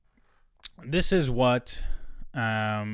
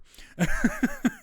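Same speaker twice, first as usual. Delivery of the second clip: high laugh; 13 semitones higher than usual. Real, not acted. Laughs after doing math.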